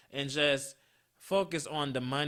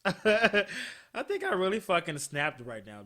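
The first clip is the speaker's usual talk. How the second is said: laughing through the words, slower; laughing at himself; 4 semitones higher than usual. Real, not acted. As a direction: chuckle at your own blowup. Laughing at his own outburst.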